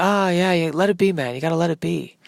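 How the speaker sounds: easygoing, hushed mock rock star. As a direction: imitate a laid-back musician, lightly and quietly. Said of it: "watching [name], [name]. He's just like".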